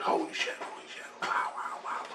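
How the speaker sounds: breathless hushed holy shit and wows; awed whisper. Retold or imitated. Awestruck watching a UFO over the river, whispering. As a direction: whisper it in awe at the lights.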